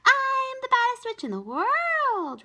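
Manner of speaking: high, slow proud witch voice; little witch voice. A narrator's reading. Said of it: "Ramona informed him".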